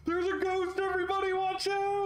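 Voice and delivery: extremely high, fast screaming; terrified warning. Acted. Worker runs screaming warning.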